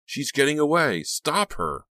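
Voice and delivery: high, urgent shout; shouting pursuers. A narrator's reading. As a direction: call out the alarm after a runaway. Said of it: "Above the shouting and honking".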